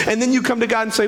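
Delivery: ordinary delivery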